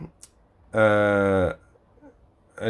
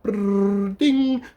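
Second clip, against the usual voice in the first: a whirring brrr, then a very high ding; mocking a clerk who mimes a microwave; microwave noise; 7 semitones higher than usual. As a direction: imitate a microwave whir and chime. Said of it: "And then they're just like".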